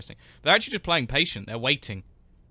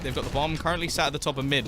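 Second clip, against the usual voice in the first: ordinary delivery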